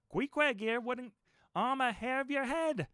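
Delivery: high, slow amused innkeeper; landlord's voice. A narrator's reading. The landlord calms the frightened guest, grinning. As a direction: reassure him with a grin.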